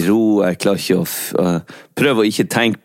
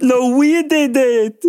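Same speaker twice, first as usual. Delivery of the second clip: high, slow, drawn-out triumphant cry; excited cheering; 14 semitones higher, much slower than usual. Retold or imitated. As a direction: shout it like a celebrating fan. Joins the retold celebration.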